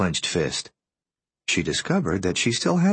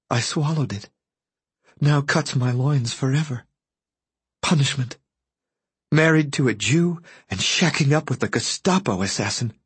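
higher-pitched woman lost in her thoughts; a touch of derangement, pitched up; disturbed woman; 7 semitones higher than usual. Acted. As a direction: lightly play her disjointed inner voice.

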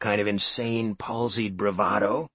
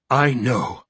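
panting; 4 dB louder, much slower than usual. A narrator's reading. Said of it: "he panted".

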